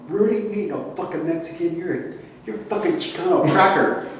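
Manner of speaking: high, fast mock-angry outburst; hint of an angry man yelling; angry retold insult. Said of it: "get really pissed off at me".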